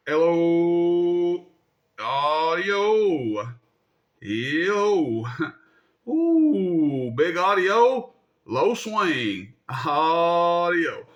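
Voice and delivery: long drawn-out hello and audio calls; playful stretched mic test; radio mic check. Shown as a demonstration. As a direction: belt out test calls to watch the meter swing. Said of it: "see how it performs on the watt meter".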